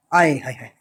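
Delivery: a low, hammed-up "आए हाय हाय"; mock lament. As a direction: sigh it like a filmy heartbreak. Mock lament, lines never meet. (नाटकीय, नकली विलाप, धीमी गहरी आवाज़ में)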